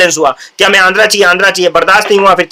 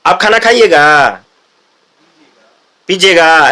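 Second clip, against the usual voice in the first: Bihari accent; 2 semitones lower, slower than usual. Shown as a demonstration. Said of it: "Bihari touch में बोलते हैं".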